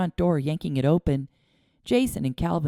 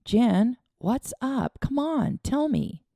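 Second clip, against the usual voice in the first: put-on boy's voice, gently coaxing; 9 semitones higher, slower than usual. A narrator's reading. Boy coaxing his crying friend, gentle. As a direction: coax a sad friend to talk.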